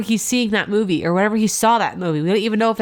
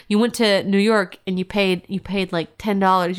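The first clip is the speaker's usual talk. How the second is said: ordinary delivery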